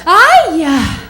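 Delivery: put-on loud, seductive Latin accent. Comic imitating her Latin ex, loud and sultry accent. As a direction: lay on a sexy Latin accent. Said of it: "it's that accent".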